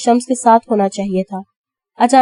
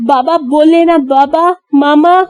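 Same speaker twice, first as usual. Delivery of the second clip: high, louder frantic calling for parents; frantic cry; 5 semitones higher, 3 dB louder, slower than usual. A narrator's reading. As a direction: cry out for her parents in panic. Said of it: "اس کی آواز میں جنونیت اور شدت واضح تھی".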